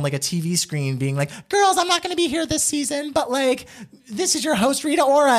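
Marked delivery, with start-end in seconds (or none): 1.5-5.4 very high-pitched TV host impression; Tyra Banks. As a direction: imitate a host's announcement in a high, put-on voice. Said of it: "Tyra pops up on".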